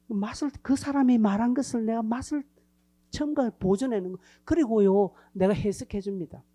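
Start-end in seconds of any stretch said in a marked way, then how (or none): none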